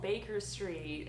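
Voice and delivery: drawn-out American "Baker Street" with a hard R; slow American-accented repeat. Retold or imitated. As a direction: imitate an American cabbie, slowly. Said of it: "put an American accent on".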